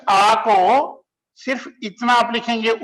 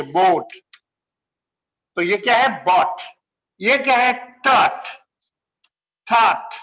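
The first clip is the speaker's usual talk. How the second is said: ordinary delivery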